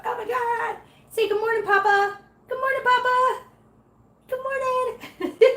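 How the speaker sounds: put-on very high baby-talk greeting; tiny squeaky pet-talk voice; cutesy kitten voice. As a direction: coo good morning way up high. Voicing good morning for the kitten.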